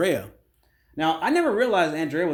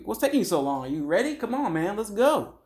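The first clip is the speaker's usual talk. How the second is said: retold impatient call, rising at the end; mock-hurry someone along, pitch climbing; lightly acted impatience; 5 semitones higher than usual. Retold impatient hurrying before a date.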